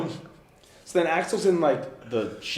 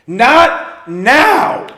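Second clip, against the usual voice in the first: roaring, much higher and louder; furious shout; 12 semitones higher, 18 dB louder than usual. Retold or imitated. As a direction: bark it to shut them down. Rock star snapping at fan.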